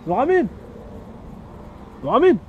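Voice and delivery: loud, high shout; shouting a name. Acted. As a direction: yell across the office. Shouting for a colleague. (appel crié et aigu)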